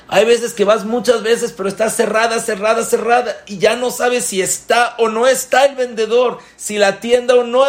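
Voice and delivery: high, loud, building exasperation; exasperated. Acted. Preacher to listeners, exasperated and high. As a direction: plead how hopeless it feels. Repeated futile visits, rising exasperation.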